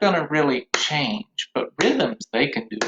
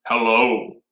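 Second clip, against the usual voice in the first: a touch lower, lightly voiced bullfrog; low frog voice; 3 semitones lower, 3 dB louder than usual. Shown as a demonstration. Teacher voices the frog puppet for the class, slightly low. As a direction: drop it down like a bullfrog. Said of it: "Bullfrog had the low voice".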